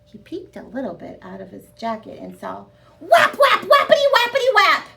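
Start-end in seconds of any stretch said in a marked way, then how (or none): 3.0-4.9 high, loud whap whap sound effects; whapping sounds. A narrator's reading. Reader sounding out Camilla's loud whapping.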